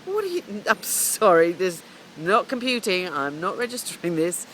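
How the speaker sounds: mother hearing her son can't play over a T-shirt, disbelieving; stunned disbelief. Retold or imitated. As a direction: act like you can't take in what you just heard. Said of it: "I'm like".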